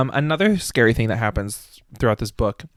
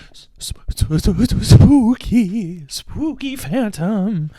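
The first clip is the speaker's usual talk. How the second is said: stretched-out, hammed-up spooky stammer; mock spooky voice; 7 semitones higher, much slower than usual. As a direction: drag out spooky like a cheap ghost story.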